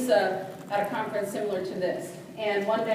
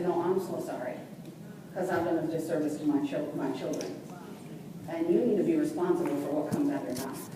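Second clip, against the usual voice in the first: lower, controlled, serious reply; stern rebuke; 5 semitones lower, 5 dB quieter than usual. Retold or imitated. Mother telling teacher to be responsible, low and stern. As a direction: hold the teacher to account in a lowered voice.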